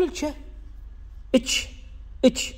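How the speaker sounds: الش said as a soft ch, اتش; Arabic kashkasha: اتش with a t-sh sound. Shown as a demonstration. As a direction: show the Bedouin اتش for the kaf. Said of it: "إنما يقول كشكشة عربية".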